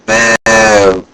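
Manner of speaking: loud mock boo at the villain; playful boo. Cosplayer booing Bellatrix in the hallway, loud mock boo. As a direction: boo her like a panto crowd.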